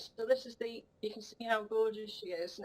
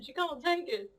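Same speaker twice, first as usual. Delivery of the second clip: ordinary delivery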